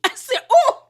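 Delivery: high, loud laughing whoop; laughing hard. Real, not acted. Recapper howling over a cast member's put-down, laughing. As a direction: crack up into a high-pitched "oh".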